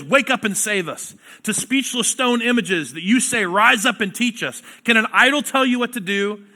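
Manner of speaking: forceful pulpit reading building up; scripture reading. Acted. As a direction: proclaim the verses with rising fire. Preacher reads scripture aloud.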